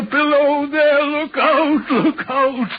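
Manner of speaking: slow, high ghostly warning call; high, drawn-out phantom warning; frightful calling. Retold or imitated. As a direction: cry out like a spectre down the line. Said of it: "It calls to me for many minutes together in a frightful manner".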